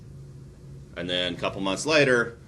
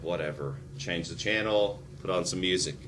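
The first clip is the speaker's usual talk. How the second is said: bored dismissal; 5 semitones lower, 5 dB quieter than usual. Retold or imitated. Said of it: "I'm like".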